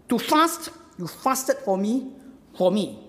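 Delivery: "fast" punched high and loud, then measured; emphatic repetition. Shown as a demonstration. Preacher showing the Hebrew doubling, emphatic repetition. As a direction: hit "fast" hard, then slow on "fasted for me". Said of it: "is emphatic".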